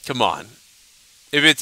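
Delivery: ordinary talk, nothing marked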